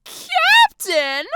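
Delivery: high, loud incredulous outburst; shrieked question; blurting out. A narrator's reading. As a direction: blurt it in outraged disbelief. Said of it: "Jalen blurts out".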